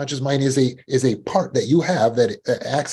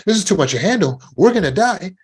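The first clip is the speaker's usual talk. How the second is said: mock panic; 10 semitones higher, 5 dB louder than usual. Voicing subconscious mind.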